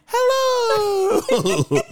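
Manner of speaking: high, loud, drawn-out hello; imitated signature hello. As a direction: call out a long, exaggerated singing hello. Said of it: "Say like you always say hello".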